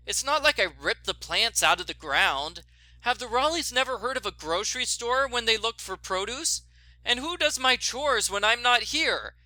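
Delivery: aggrieved Nancy. Acted. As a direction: whine it to yourself, hard done by.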